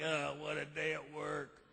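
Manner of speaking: low, slow weary groan; beat down. Retold or imitated. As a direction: drag it out, worn down from work. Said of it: "just beat down like".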